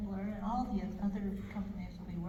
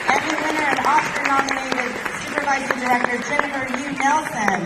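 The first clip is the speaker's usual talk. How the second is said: loud, booming stage announcer; 7 semitones higher, 19 dB louder than usual. Acted. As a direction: call out each name to the hall. Announcing the presenters.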